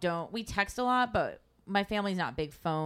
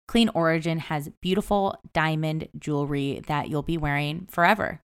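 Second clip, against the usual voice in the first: ad read; 7 dB louder than usual. Acted. Host reads sponsor ad.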